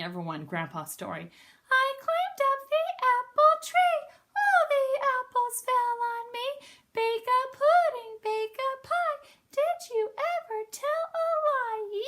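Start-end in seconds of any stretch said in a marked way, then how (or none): 1.7-12.0 very high, slow bird chant; sing-song it up high like a bird. Acted. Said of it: "I like your bird voice".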